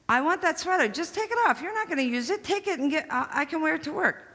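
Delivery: high, pleading demand for the sweater; whiny sister. Retold or imitated. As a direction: beg like a spoiled older sister.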